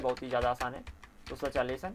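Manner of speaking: ordinary delivery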